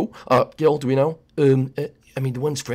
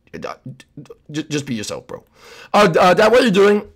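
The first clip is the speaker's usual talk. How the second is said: ordinary talk, nothing marked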